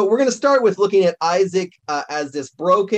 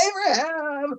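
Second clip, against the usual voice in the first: high excited call across heaven; thrilled shout of a name; 11 semitones higher, 4 dB quieter than usual. Pretend. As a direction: call out to a hero you spot. Calling out to Abraham in heaven.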